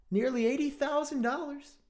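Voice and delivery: shocked high read of the price; disbelief. Real, not acted. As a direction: read it like you can't believe it. Reading shocking price aloud.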